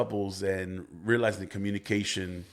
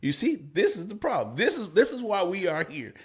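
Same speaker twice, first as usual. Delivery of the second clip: higher mock protest from the wife; imitating the wife; 10 semitones higher than usual. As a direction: act out the exasperated young lady, pitched well up. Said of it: "She'll be like".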